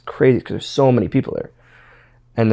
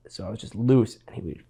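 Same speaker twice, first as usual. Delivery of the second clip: ordinary delivery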